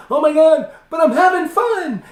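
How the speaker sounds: high, loud mock-panicked shout; freaking out. Coach imitates client panicking in performance, hammed-up freak-out. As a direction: yell it like someone comically freaking out. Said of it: "if you're freaking out".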